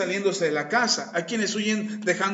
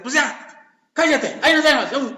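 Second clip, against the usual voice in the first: higher, louder mock snap, half laughing; immature brush-off; 5 semitones higher, 5 dB louder than usual. Pastor acting the immature one walking out of a fight. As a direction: cut the talk short and storm off. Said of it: "le dice".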